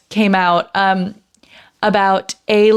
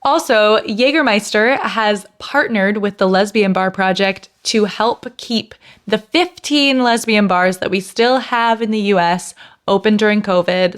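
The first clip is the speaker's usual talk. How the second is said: ordinary delivery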